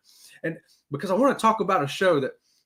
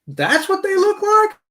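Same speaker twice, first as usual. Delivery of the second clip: much higher, slow, drawn-out disbelief; shocked disbelief; 6 dB louder than usual. Retold or imitated. Listener seeing a radio host's photo, high slow disbelief. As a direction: pull it out high like you can't believe it. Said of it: "you're like".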